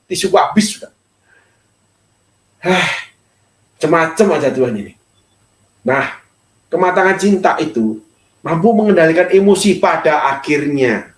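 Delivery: normal talking voice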